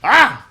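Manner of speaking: sudden high loud frustrated shout; short furious scream of frustration; frustrated yell. Real, not acted. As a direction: let out a burst of rage. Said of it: "I'm so passionate about pizza".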